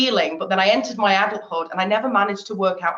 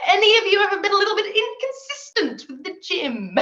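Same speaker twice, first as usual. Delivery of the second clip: laughing; 10 semitones higher than usual. Real, not acted. Teasing audience about gym habits.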